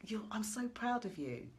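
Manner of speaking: high, loud gushing squeal of joy; excited scream. Pretend. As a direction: shriek it like a thrilled friend running up the path. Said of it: "running up your front path screaming and going".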